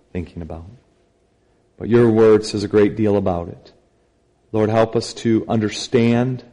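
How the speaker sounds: prayer. Acted. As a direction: pray quietly and reverently. Leads a brief prayer.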